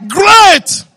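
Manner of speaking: preaching shout. Acted. Preacher proclaims peace to the church, booming. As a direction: thunder it out to the congregation.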